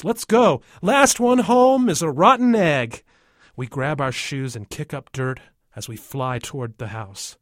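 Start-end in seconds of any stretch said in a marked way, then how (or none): 0.0-3.0 high, loud race challenge; excited shout. A narrator's reading. James challenges the others to race home, shouting. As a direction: holler it like a kid taking off. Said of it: "James shouts".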